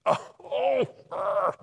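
high, drawn-out "oh" and "ah"; thrilled cry. Real, not acted. Preacher overcome by the three men's speech, thrilled cry. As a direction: cry out in high-pitched delight.

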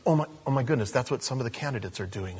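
softly voiced mock realization; quick, hushed aside as a listener catching on; imagined listener's reaction. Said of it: "He's like".

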